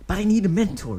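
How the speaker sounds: put-on a kid pushing his case; child voice. Deidara insisting to Obito, high child voice.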